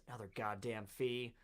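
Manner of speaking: mildly exasperated, under your breath; weary grumble. Acted. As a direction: grumble it quietly, as after a sigh. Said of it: "He gives a sigh".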